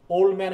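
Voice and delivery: put-on "old man" said as "ol-man"; dropped d. Shown as a demonstration. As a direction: run "old man" together with no d. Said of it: "ডিটা বাদ পড়ে গেছে". ("old man"-এ d বাদ দিয়ে বলা)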